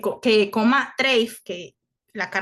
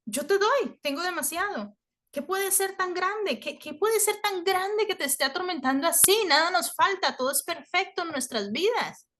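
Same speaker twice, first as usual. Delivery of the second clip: higher, pleading voice, slowing on the questions; pleading friend; 7 semitones higher than usual. Retold or imitated. Friend begging the grieving man to open up, high and pleading. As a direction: beg him to say what's wrong.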